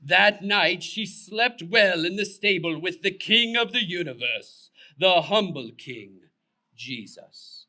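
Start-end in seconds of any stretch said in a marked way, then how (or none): none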